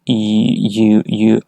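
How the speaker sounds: "y, you, you" with a distinct y glide; consonant y sound. Shown as a demonstration. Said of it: "es un sonido de una consonante".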